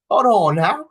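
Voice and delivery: loud rising hold on now; sharp stop, rising at the end; mock alarm. Real, not acted. Reacting to the clip, a loud startled hold on now.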